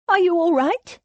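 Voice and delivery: high, concerned woman's voice; worried woman. A narrator's reading. Rose checking on Thomas after an attack, worried. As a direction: ask it high and anxiously.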